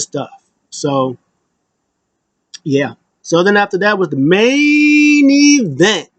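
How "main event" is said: drawn-out, higher announcement; Mark Henry. Retold or imitated. Host announcing the main event, drawn-out impression. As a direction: stretch it like a hype announcer. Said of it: "Shout out Mark Henry".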